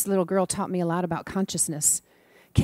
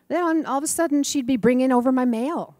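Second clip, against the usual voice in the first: ordinary talk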